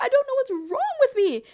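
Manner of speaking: high, loud acted wail; hammed-up despairing cry at yourself; tearful outburst. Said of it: "I'm, like, crying".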